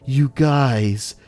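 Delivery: mock the preachy guy again; douchey YouTuber. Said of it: "he's all like".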